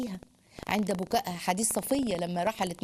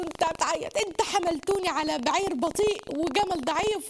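imitating crying complaint; 7 semitones higher than usual. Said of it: "فبتبكي وتعيط".

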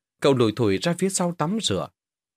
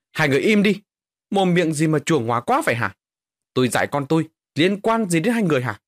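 raised pitch and voice, indignant; angry rebuke; 5 semitones higher, 4 dB louder than usual. Acted. Mother rebuking two co-wives for meddling with her son, angry. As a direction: tell them off sharply, defending your son.

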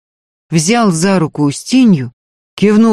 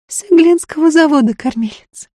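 high, willing woman's reply; eager peasant woman; 12 semitones higher than usual. A narrator's reading. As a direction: answer readily, keen to please. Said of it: "охотно пояснила Фроська".

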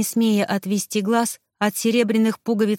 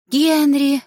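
higher-pitched, amazed exclamation; breathed-out recognition; 6 semitones higher, 4 dB louder than usual. A narrator's reading. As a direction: exhale the name in joyful recognition. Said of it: "выдохнула она".

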